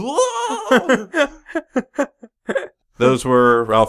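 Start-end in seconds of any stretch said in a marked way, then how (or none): 0.0-1.1 very high laughing whoa; hammy death yell. Retold or imitated. Podcaster quoting Ralph Garman's death yell, very high. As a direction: scream it like a B-movie death. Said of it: "I went".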